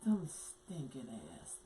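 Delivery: low, slow, grim voice of an uncle cursing; menacing uncle. Retold or imitated. Uncle cursing people out, low and slow. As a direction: drag the slur out low and slow like a bitter old uncle. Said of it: "he would be like".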